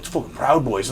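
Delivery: soft, retold onlookers' murmur; quiet mock accusation; hushed accusation. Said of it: "they're like".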